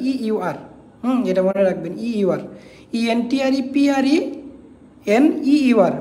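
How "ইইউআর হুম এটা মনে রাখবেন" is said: ordinary talk, nothing marked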